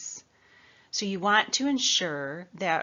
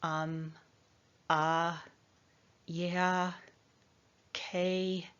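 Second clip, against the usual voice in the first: filler sounds; much slower than usual. Shown as a demonstration. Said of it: "We'll say things like".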